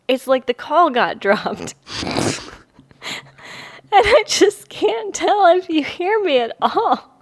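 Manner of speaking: giggling through the words; trying to hold back laughter; stifled giggles. Real, not acted. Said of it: "I laugh".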